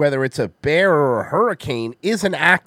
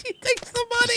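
mock high voice; 4 dB quieter than usual. Mocking clip line, high voice.

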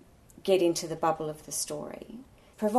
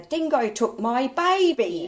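hammed-up bad Australian accent, high, loud and slow; mock a botched Aussie film accent, drawn out; Meryl Streep; 7 semitones higher, 7 dB louder, slower than usual. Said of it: "Meryl Streep in Evil Angels with a".

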